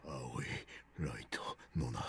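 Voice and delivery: faint dazed mumble; mumbling. Acted. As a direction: mumble the names almost inaudibly. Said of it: "Seven was mumbling to himself".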